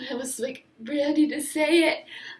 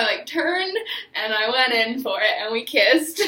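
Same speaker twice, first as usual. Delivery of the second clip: ordinary talk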